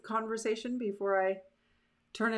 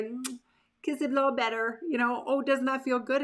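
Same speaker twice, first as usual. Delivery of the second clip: cooing parent; 5 semitones higher than usual. Retold or imitated. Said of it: "And it's like".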